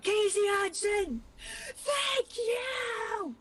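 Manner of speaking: high shouted call over a crowd; screaming. Retold or imitated. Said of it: "I screamed at Casey Hudson".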